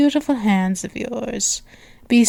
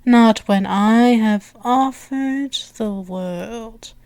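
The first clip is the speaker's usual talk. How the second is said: ordinary delivery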